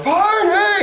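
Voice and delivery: party shout. Retold or imitated. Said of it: "everyone was like".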